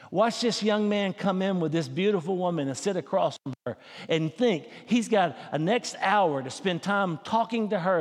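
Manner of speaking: ordinary delivery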